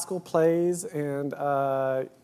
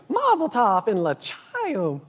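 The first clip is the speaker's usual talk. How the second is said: hammy fake Yiddish accent; imitating a bad Yiddish accent; 6 semitones higher, much slower than usual. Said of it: "we all just sounded like this".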